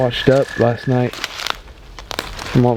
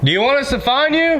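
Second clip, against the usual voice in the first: yelling across the room; 13 semitones higher than usual. Real, not acted. Calling out to friends far off.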